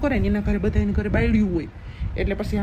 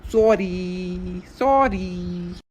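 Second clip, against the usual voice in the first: long drawn-out sorry, held on one note; coaxing apology; 3 semitones lower, much slower than usual. Acted. Husband begs wife to drop her sulk, coaxing drawn-out apology. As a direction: stretch each sorry out pleadingly.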